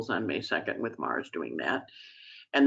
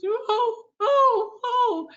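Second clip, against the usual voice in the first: very high, wailing kitten cries; crying kitten; 5 dB louder, much slower than usual. Retold or imitated. Ted the kitten crying for the speaker, a high wailing mew. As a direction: mew and cry like a kitten reaching out. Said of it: "Ted's crying for me".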